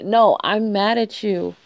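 ordinary talk, nothing marked

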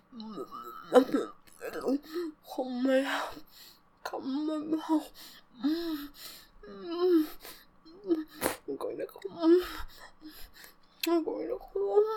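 rising, crying, broken words; breathless climax. Acted. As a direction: let it build and break. Building climax in the roleplay.

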